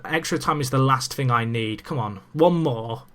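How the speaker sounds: usual speaking voice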